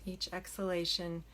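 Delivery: soft, unhurried meditation voice with long pauses; hushed, settling delivery, leaving room to breathe; guided meditation. Acted. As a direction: gently guide them into stillness. Leads a meditation.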